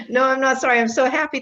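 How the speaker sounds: happy playful correction; cheerful retort. Real, not acted. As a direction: take it back with delight. Takes back the apology.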